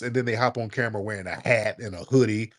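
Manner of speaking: ordinary talk, nothing marked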